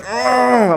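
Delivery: high exasperated groan of disgust; disgusted ugh. Retold or imitated. Father recalling his frustration, a high disgusted ugh. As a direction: let out a fed-up ugh.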